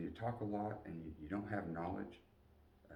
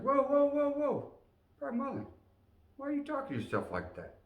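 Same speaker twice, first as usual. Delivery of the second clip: much higher, loud startled sergeant voice; 5 dB louder than usual. Retold or imitated. Sergeant stopping a private cursing himself, high and loud. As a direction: play the drill sergeant cutting him off, high and loud. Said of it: "Sergeant Pearson's like".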